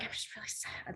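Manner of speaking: whispered, sorrowful; hushed sad confession; sad example. Shown as a demonstration. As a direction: say it like you're truly down. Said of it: "So I'd be like".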